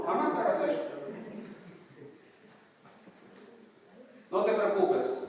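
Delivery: high, loud, fiery sermon; full-force preaching. Acted. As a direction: preach it hard to the congregation.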